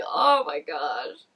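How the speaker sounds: slow, pained laugh; laughing oh my gosh; laughing groan. Real, not acted. Reacting to awkward scene.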